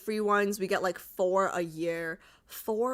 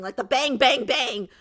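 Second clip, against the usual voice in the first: punchy bang bang, louder; gunshot sound effect; 3 semitones higher, 6 dB louder than usual. Shown as a demonstration. Streamer recalling the sound effects, punchy bang noises. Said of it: "with the sound effects".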